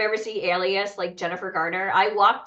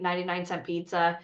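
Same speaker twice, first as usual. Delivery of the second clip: usual speaking voice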